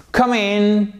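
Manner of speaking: high, sing-song "come in"; high, drawn-out invitation; welcoming call. Shown as a demonstration. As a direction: call customers in on a held high note. Welcoming customers, shop scene.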